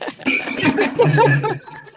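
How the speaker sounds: airy high laugh; high breathy laugh. Real, not acted. Laughing after her story.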